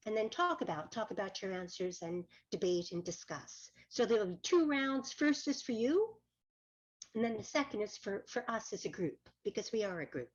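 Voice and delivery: faint, dropping-out online audio; bad connection, voice fading in and out; voice cutting in and out. Real, not acted. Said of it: "your voice going in and out a bit".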